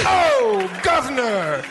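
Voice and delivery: very high, loud squeaky Cockney "Governor"; shrill hammed-up Cockney; mock Cockney. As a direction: screech it like a music-hall Brit. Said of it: "most British accent I've ever heard".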